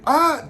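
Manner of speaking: high, loud acted shriek; mock scream of mutual fright; startled scream. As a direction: yelp as if jumped. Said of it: "they're scaring each other".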